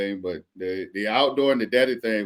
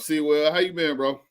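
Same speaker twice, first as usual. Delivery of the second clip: normal talking voice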